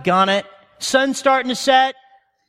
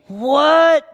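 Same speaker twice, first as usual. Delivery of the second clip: confused disciple; 5 semitones higher, 4 dB louder than usual. Retold or imitated.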